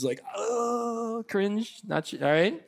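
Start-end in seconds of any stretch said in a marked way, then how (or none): 0.3-1.8 high, softer groan of secondhand embarrassment; hammed-up wincing; mock cringe. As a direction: groan it as if peeking through your hands. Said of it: "looking through your hands".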